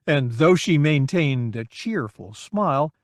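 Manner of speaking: ordinary delivery, nothing marked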